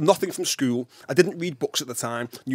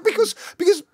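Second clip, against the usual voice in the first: flustered insistence; 3 dB louder than usual. Retold or imitated. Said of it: "I'm saying".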